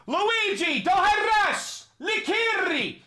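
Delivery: high, drawn-out command cry; shouted dog command. Real, not acted. Owner orders dog off the cat in High Valyrian, high commanding shout.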